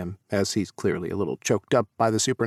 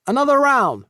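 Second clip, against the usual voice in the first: put-on broad Australian accent, much higher and louder; 7 dB louder than usual. A narrator's reading. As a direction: ask it like a broad Aussie bloke at the bar. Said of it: "he asks in his broad Australian accent".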